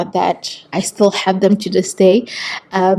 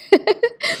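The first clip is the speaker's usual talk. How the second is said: shy laugh; 13 semitones higher, 3 dB quieter than usual. Real, not acted.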